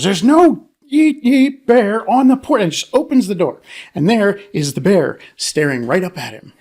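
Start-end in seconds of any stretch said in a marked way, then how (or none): 0.0-2.6 put-on high, loud, slow blustering old man; storming grandfather. Grandfather storming to the door, blustering loudly. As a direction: stomp in insisting there's no bear. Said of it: "storms over to the door, goes".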